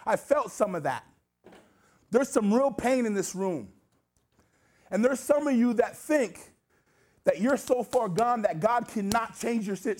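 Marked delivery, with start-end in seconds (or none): 2.1-10.0 raised-pitch, emphatic sermon delivery; impassioned preaching. Real, not acted. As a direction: press each phrase home to the congregation. Preaches with force to congregation.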